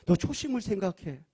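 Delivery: ordinary delivery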